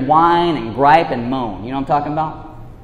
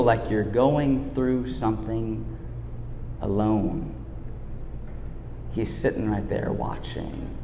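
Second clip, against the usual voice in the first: soft, slowing sermon voice that fades to a hush; tender preaching; 7 semitones lower, 9 dB quieter than usual. Acted. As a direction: draw them in gently, almost whispering. Soft slow preaching climax.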